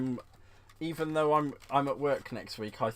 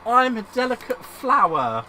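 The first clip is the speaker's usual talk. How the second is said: higher, louder hammed-up daintiness; mock dainty; 8 semitones higher, 12 dB louder, slower than usual. Insisting to viewers she's a delicate flower, hammed up. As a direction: play the precious flower for laughs.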